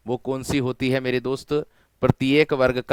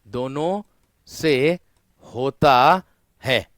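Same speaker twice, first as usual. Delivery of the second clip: slow, word by word with pauses; teacher dictating slowly to students; slow emphatic dictation; slower than usual. Shown as a demonstration. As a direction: spell it out slowly for the class. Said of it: "ध्यान देना मेरे दोस्त".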